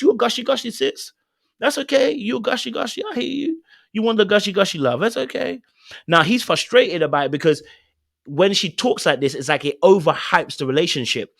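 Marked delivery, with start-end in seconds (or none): 0.0-5.6 high, sing-song teasing voice; playful teasing. Pretend. Host teasing Tia that she loves romance, high playful coo. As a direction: coo it at her like a doting big sister.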